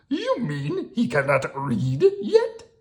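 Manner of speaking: high, slow, chuckling monster voice; Grover voice. Acted. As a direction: Grover teasing about reading. Grover voice, laughing.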